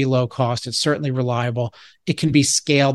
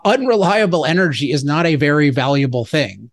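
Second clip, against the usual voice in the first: ordinary talk, nothing marked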